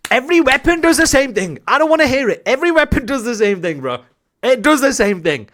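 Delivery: high, loud, insistent; exasperated rebuke. Real, not acted. Coach refusing excuses about an AR, loudly exasperated. Rebuffing players' objections about weapons.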